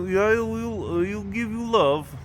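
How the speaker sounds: high, slow mock Russian voice for the cat; Russian accent. Vlogger voicing the flirty cat, in a mock Russian accent. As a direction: ham up a thick Russian accent, slowly. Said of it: "in a Russian accent".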